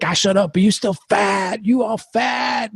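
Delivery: high, jeering taunt that climbs to a shout; mocking bully. Bully taunting the boy in the van, high mocking shout. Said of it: "He's like".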